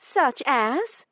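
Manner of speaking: quick, high challenge; snappy comeback. A narrator's reading. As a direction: shoot the question back. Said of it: "she shot back".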